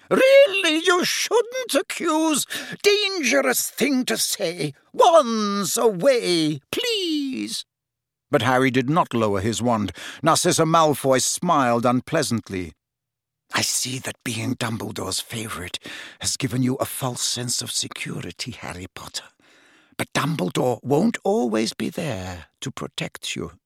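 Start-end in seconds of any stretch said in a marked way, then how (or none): 0.1-7.6 very high, slow, panicked squeal of a fussy seamstress. A narrator's reading. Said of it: "Madame Malkin squealed". 13.5-23.5 cold aristocratic mother. A narrator's reading. Narcissa taunts Harry.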